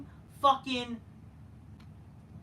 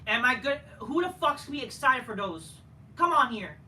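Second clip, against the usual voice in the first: normal talking voice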